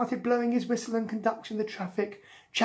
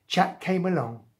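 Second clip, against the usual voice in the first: ordinary delivery, nothing marked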